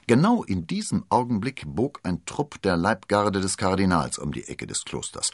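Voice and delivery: usual speaking voice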